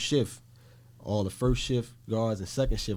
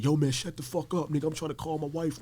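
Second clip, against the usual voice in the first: higher-pitched mock argument; imitated arguing; 7 semitones higher than usual. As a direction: act out an irritated inmate snapping back.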